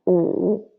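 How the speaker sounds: U as "oo", dipping then rising; third tone. Shown as a demonstration. Teacher drilling u line, dipping. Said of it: "And then u".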